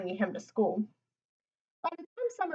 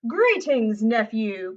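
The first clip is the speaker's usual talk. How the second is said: loud, hearty uncle's greeting; cried greeting; 8 dB louder, much slower than usual. A narrator's reading. Uncle greeting his nephew on his birthday, loud cheerful cry. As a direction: call out to your nephew. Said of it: "cried Lewis's Uncle McAllister".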